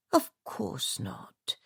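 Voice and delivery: soft, slow murmur. A narrator's reading. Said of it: "Phillida murmured".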